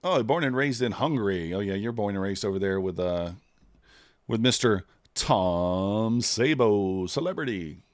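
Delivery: usual speaking voice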